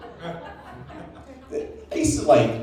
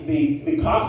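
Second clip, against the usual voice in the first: normal talking voice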